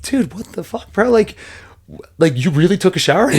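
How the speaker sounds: can't believe he actually did it; retold disbelief. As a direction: coach scolding fighter, mock incredulous. Confronting fighter who showered.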